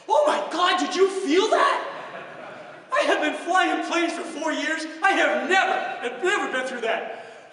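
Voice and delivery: put-on high, loud, slow panicky young pilot; hammed-up terror on the intercom, voice cracking with a laugh; nervous pilot. Young pilot panicking over the intercom, hammed-up nervous shout. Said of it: "nervous-sounding pilot".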